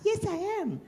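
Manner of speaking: put-on very high, soft sweet girl's yes; Cinderella's reply. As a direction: squeak it out sweetly like a girl. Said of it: "Cinderella answers again".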